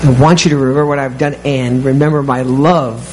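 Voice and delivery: ordinary delivery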